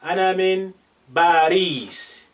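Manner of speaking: "baris" with a b, not a p; Arabic b for p. Shown as a demonstration. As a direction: say "baris" with a b sound. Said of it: "So you use ba instead".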